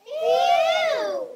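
high, drawn-out "ew"; disgusted cry. Acted. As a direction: squeal it out in mock disgust. Cows react with disgust.

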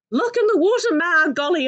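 put-on startled child shouting to her mother; alarmed shriek. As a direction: call out in alarm to Ma. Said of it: "I shrieked and I squealed".

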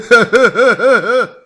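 high braying cackle; hammed-up loud guffaw; loud mocking laugh. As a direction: bray out a dumb crowd laugh. Bystander laughs.

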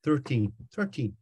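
"Thirteen" with a hint of an Irish lilt; light Irish "Thirteen"; Irish-sounding thirteen. Shown as a demonstration. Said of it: "which sounds a bit Irish".

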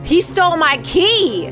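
gasp, then high, loud mock outrage; loud, slow, playful indignation; shocked outcry. Real, not acted. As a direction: cry out high and drawn-out, scandalized. Gasping mock outrage at character.

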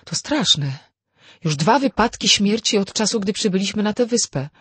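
crying out. A narrator's reading. Vera exclaims over two deaths on the island, crying out. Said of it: "Vera zawołała".

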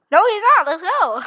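high, loud burst of relief; excited relief. Real, not acted. Relief at escaping the seeker.

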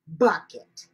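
ordinary delivery

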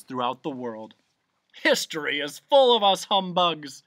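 high, grand pompous proclamation; blustering humbug. A narrator's reading. Humbug vaunting his noble family, pompous bluster. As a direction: boast loudly, savoring the grand phrases.

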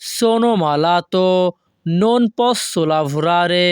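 very slow, careful Italian model reading; teacher pacing it out; slow model sentence. Shown as a demonstration. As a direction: draw each word out for learners. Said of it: "তাহলে Italian ভাষা".

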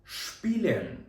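Шпілен with sh before p; German sp as shp. Shown as a demonstration. Teacher answers own question for the class: Шпілен with sh. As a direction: model Шпілен, sh-p at the start. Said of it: "Яке слово?".

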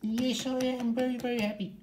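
very high toy voice full of delight; squeaky happy toy. Pretend. Toy answers happily.

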